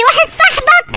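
a sudden high outraged question; jealous wife, scandalised, snapping back; shrill disbelief. Acted. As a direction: shoot the question up in shocked outrage. Jealous wife catches him.

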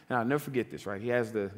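ordinary delivery

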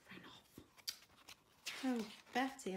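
plain reading voice

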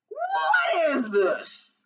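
high, very drawn-out roar; outraged giant. Acted. Giant holding Jack up to his wife, outraged. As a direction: demand it slowly, falling from high.

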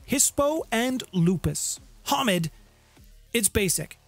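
ordinary talk, nothing marked

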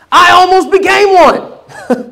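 laughs through it, voice jumping up; a rueful laugh at himself; half-laughing confession. Real, not acted. Admitting near-fall, laughing.

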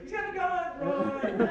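high, loud mock alarm shout; panicked yell. Everyone fleeing the gunman, shouting a loud mock warning. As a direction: yell it like you're fleeing a gun. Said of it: "because it's like".

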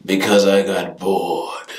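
ordinary talk

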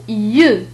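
"يو" as a drawn-out y sound; Y glide. Shown as a demonstration. Teacher explaining French igrec, drawn-out "يو". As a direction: slowly say "يو", a y with rounded vowel. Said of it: "تنطق".

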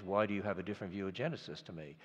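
normal talking voice

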